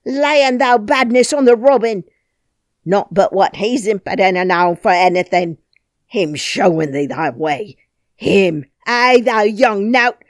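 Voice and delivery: loud Yorkshire scolding; outraged. Acted. Outraged Ben scolds Mary over the robin, loud and scornful. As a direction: spit out the scorn at her. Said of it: "he was so outraged".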